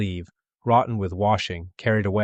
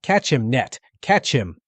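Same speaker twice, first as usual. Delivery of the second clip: urgent cry; 4 semitones higher, 4 dB louder, slower than usual. A narrator's reading. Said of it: "crying to the nearer sister".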